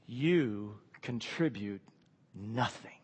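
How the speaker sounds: soft, slow pronouncement; quiet solemn verdict. Retold or imitated. The gospel speaking to sinners, softly final.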